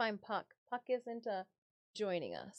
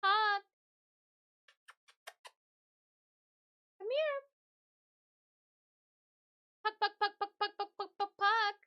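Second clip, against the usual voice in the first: calling the cat; 11 semitones higher, 4 dB louder than usual. Real, not acted.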